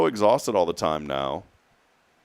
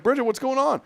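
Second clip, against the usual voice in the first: very high, alarmed questioning; high-pitched mock bewilderment; startled question. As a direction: retell calling out in disbelief. Retold startled question at night.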